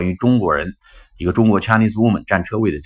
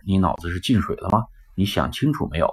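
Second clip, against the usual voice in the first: normal talking voice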